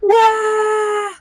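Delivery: high, mock baby cry "wah"; hammed-up infant crying at full strength; baby wail. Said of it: "he's crying the whole time".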